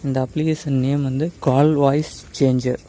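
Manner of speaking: usual speaking voice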